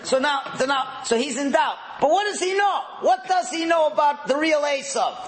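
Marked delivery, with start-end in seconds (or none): 2.0-5.3 high, insistent rhetorical question; worked-up teacher pressing the point; emphatic questioning. Real, not acted. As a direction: drive the question home, pitched way up.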